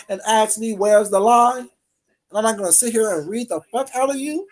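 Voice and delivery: normal talking voice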